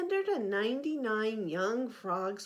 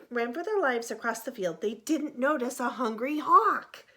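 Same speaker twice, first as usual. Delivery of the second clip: usual speaking voice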